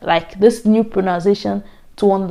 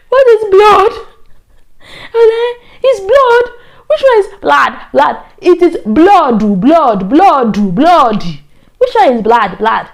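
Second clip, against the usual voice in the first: laughing mock; 8 semitones higher, 6 dB louder, slower than usual. Whole class mocking in laughter.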